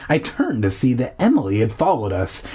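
usual speaking voice

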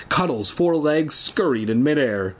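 ordinary delivery, nothing marked

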